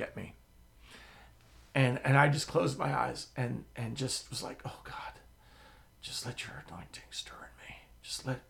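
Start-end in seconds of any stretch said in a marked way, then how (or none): 4.6-8.4 very soft, unvoiced pleading; whispered prayer. Preacher whispering to God as Rodney stands before him. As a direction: pray under your breath.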